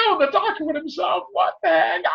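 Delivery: high-pitched horrified cry; shocked cry. Retold or imitated. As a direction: shriek it in disbelief. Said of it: "I was like".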